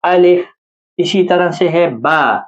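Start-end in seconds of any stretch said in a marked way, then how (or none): 0.0-0.5 الف said with the Arabic open a, not the Urdu sound; Arabic alif. Shown as a demonstration. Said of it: "بلکہ جو عربی لہجہ ہے اس زبان میں آپ پڑھیں".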